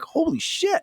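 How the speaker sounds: high surprised yelp. Retold or imitated. Host opening the package of dirt, surprised yelp. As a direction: yelp it in shock. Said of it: "I'm like".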